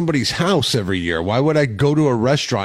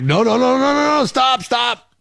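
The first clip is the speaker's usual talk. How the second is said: high, loud panicked protest; frantic no no no; 14 semitones higher, 3 dB louder than usual. Retold or imitated. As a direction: shout it, pushing the food away. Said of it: "I'm like".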